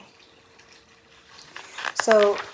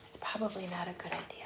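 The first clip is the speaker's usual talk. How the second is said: whispered aside; 2 semitones lower, 12 dB quieter, faster than usual. Real, not acted. Teacher whispering a warning to the children. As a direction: breathe it as a secret aside. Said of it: "aside to listeners, whispered".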